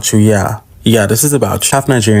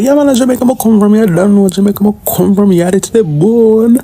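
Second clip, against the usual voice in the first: much higher, hammed-up silly voice; mock voice answering the guy; mock reply; 10 semitones higher than usual. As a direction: play a goofy caricature of himself. Mocking his own reply at airport.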